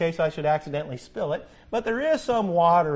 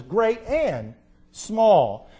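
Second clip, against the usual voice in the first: very slow emphasis; much slower than usual. Acted. Preacher stressing all vessels.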